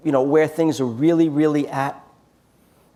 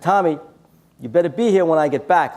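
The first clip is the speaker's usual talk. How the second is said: firm call; 5 semitones higher, 6 dB louder than usual. Retold or imitated. Warning homeless man jokingly.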